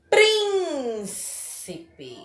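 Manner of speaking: "Príncipe" with PRÍN high and stretched, then falling; stress on PRÍN. Shown as a demonstration. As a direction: say "Príncipe" as PRIIIN... ci-pe. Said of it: "é a antepenúltima sílaba mais forte". ("Príncipe" com o PRÍN agudo e bem alongado)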